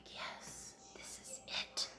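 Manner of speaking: hushed, reverent whisper; awed whisper. Retold or imitated. Mom whispering over the perfect scheduler. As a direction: breathe it out in wonder. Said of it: "it's just like".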